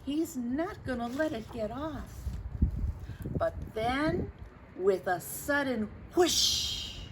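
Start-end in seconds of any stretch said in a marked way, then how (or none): none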